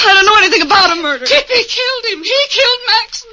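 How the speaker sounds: fast, frantic denial; panicked. Acted. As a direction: blurt it out in a panic. Mother panics defending daughter.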